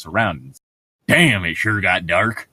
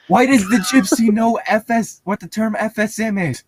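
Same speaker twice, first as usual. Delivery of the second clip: incredulous; 12 semitones higher, 4 dB louder than usual. Real, not acted. Incredulous questioning.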